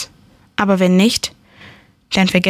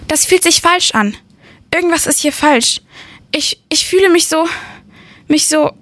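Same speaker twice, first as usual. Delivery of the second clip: much higher, unsettled and anxious; alarmed, higher pitched distress; rising panic; 8 semitones higher than usual. Acted. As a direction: voice climbing as something feels wrong. Narrator panics after losing pain.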